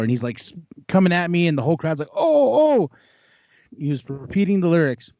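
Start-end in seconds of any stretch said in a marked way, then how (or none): 2.1-2.9 high hyped-up crowd ooh. Retold or imitated. Crowd reacting to a rap battle, high hyped ooh. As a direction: ooh like a crowd that smells a diss.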